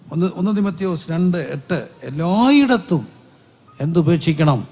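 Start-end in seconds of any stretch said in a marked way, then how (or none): 2.0-3.1 എല്ലായിടത്തും drawn out slowly; stress on എല്ലായിടത്തും. Real, not acted. Preacher drives the verse home, stretching എല്ലായിടത്തും.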